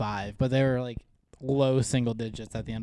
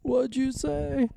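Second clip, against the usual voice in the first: put-on old man voice; 14 semitones higher than usual. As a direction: stretch it out like a confused grandpa. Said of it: "you like my old man voice".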